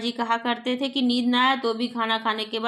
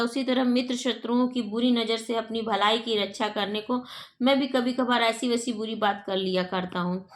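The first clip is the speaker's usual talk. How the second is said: ordinary talk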